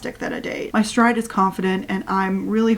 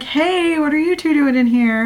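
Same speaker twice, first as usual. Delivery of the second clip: higher, bright greeting, a bit louder; a higher little-sister voice calling out; higher, louder surprised hello; lightly voiced Nellie; 6 semitones higher, 3 dB louder than usual. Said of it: "Nellie's like".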